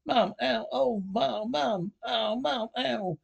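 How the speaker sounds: yelping in pain. Acted. Son yelping as his mother hits him in front of everyone, pained yelps. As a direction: cry out as each blow lands.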